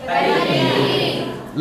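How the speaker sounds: breathy, very slow परिधि; drawn-out whispered answer. Shown as a demonstration. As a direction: whisper the answer long for the class. Teacher gives class the answer. (फुसफुसाकर खींचा हुआ परिधि)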